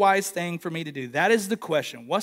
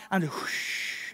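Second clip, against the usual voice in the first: whoosh sound; 2 semitones lower, 9 dB quieter, slower than usual. Retold or imitated. Gliding down fresh powder.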